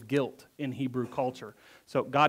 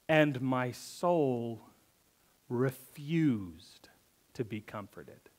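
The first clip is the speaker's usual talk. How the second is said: slow, softer, fading to almost a whisper; hushed scripture; 4 semitones lower, 7 dB quieter, much slower than usual. Shown as a demonstration. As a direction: let it sink to a breath. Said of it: "I'd like to Hebrew that for a second".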